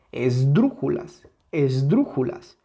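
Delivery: esdrújulas with a strong beat on DRU; stress on tonic syllable. Shown as a demonstration. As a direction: lean hard into the DRU of esdrújulas. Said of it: "la que tiene mayor intensidad". (esdrújulas con la sílaba DRÚ muy marcada)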